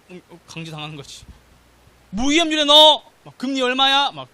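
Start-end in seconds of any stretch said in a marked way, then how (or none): 2.1-4.1 very loud, high-pitched demands; imitated wife's loud nagging; scolding wife. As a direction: order your husband about at full volume. Wife scolding him, acted out.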